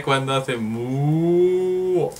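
slow drawn-out mu like a cow; cow moo. Shown as a demonstration. Teacher imitating a cow's mu for the class. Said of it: "Es como las vacas".

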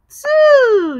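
Two said high and loud as the number two; model the word. Shown as a demonstration. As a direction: call out Two like counting. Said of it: "Can you say that again?".

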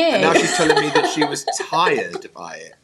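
mock-indignant through a laugh; laughing mock outrage.

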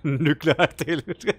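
slow "Nükleer Taylor" squeezed out through laughter; laughing through it. Real, not acted. Gamer repeating the nickname while still laughing.

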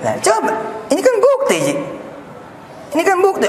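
thrilled, pointing at the coffee proof; excited. Real, not acted. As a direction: cry out that this is proof.

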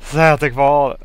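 loud, urgent plea; loud pleading. Real, not acted. Player begs that it is still there, pleading loudly.

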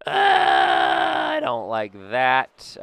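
loud groan into slow complaint; loud dismay. Real, not acted. Player reacting to a failed hit, loudly dismayed. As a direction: groan it out loudly, then stretch the words.

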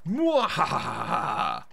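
slow cartoon villain cackle; evil laugh. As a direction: stretch out a hammy evil cackle. Devil laughs.

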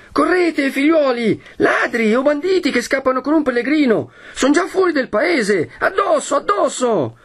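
very high-pitched rallying cry; shouted alarm. A narrator's reading. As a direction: urge the crowd into pursuit. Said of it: "arriva un altro ... e grida". (grido acuto di caccia ai ladri)

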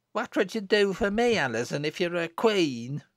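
put-on high little-girl voice. Little Jennet asking her sister, high child's voice. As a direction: ask up high like a small child.

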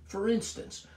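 usual speaking voice

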